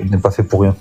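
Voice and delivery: ordinary talk, nothing marked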